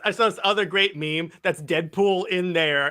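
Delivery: ordinary talk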